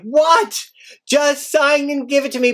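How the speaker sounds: high shouted what, then urgent plea; frustrated partner shouting down the phone; exasperated shout. Retold or imitated. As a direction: yell at him to just sign. Panicked reaction to phone news.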